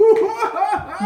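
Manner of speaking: high, loud whooping laugh; hammed-up villain laughter; dragon king's cackle. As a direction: cackle gleefully at the destruction. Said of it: "he's just like".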